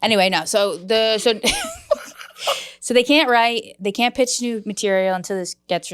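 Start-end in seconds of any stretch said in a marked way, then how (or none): 1.4-2.7 squealing laugh, almost crying; high laughing fit. Real, not acted. Bursts into laughter.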